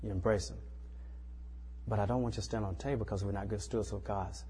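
soft, calm comfort after the spanking; a gentle, loving parent's voice; tender reassurance. Retold or imitated. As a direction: hold him and speak softly. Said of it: "In that tone of voice".

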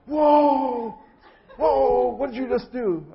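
high, loud alarmed yelling; startled upset shout. Retold or imitated. To the chiropractor after the neck crack, startled loud shout. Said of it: "I was really upset".

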